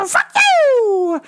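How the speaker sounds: high, loud screeching curse; crazed scream. Retold or imitated. Woman walking into traffic, loud crazed screech. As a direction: shriek it at the passing cars.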